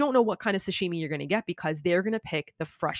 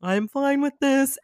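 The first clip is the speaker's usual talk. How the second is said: self-convincing; 8 semitones higher, 6 dB louder, slower than usual. Retold or imitated. Forcing himself to eat sperm sac.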